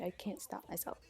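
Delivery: breathy whisper, half to herself. Real, not acted. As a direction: whisper it like a guilty confession. Confessing her habit in aside.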